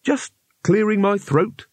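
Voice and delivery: high, slow, innocent cover-up; fake innocent.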